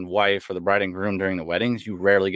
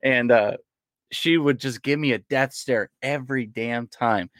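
normal talking voice

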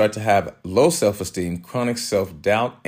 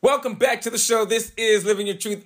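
higher upbeat announcer voice; radio host voice; 13 semitones higher than usual. Acted. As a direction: sign off for the break like a DJ. Show return announcement.

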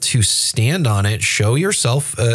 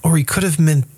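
higher, hedging and noncommittal; evasive pastor voice; 6 semitones higher than usual. Retold or imitated. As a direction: dodge like a slippery preacher. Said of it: "it's this kind of like".

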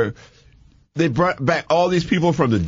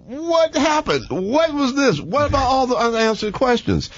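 disappointed fans; 11 semitones higher than usual. Retold or imitated. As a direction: play the let-down crowd, high and dragging. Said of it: "Everybody said".

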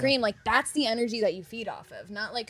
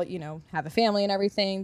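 ordinary delivery

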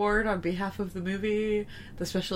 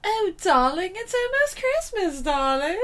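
put-on much higher, louder sing-song posh accent; posh Rainbow Dash accent; 10 semitones higher, 5 dB louder than usual. Co-host imitating the pony's posh Christmas accent, high and loud. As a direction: gush it like a grand dame. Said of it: "Rainbow Dash, Very Minty Christmas".

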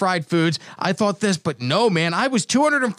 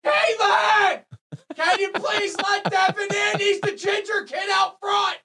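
very high, stretched-out yell across the house; shouting to someone far off; 14 semitones higher, slower than usual. Real, not acted. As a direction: holler for help from another room. Yells for Caitlin off air.

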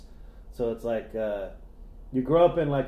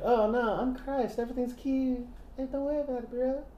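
high, soft, prissy mock voice; sweet airy mockery of Jesus; 12 semitones higher, 5 dB quieter than usual. Mocking Christ voice, very high.

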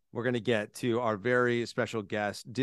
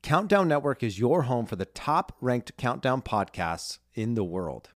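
upbeat sponsor announcement voice; ad read; 5 semitones higher, 5 dB louder than usual. Acted. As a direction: read it like a podcast ad. Sponsor read at podcast open.

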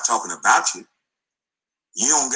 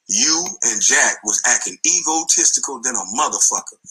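usual speaking voice

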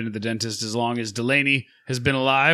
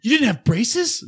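mock gushing dentist; 11 semitones higher than usual. Dentist gushing over the patient's teeth, high mock voice. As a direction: coo it like an envious dentist.

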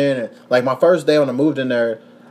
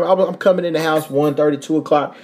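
ordinary delivery

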